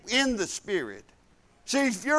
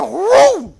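excited whoop; 9 semitones higher, 12 dB louder than usual. Real, not acted. Racing off at the light.

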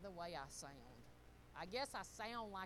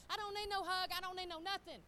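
mock defiance; 9 semitones higher, 6 dB louder than usual. Acts out her own tough attitude.

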